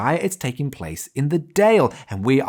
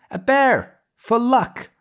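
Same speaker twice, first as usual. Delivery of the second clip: put-on high, bright little girl voice; cheerful girl voice; 12 semitones higher, 4 dB louder, slower than usual. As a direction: sing it out like a bouncy young girl. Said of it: "Patty says".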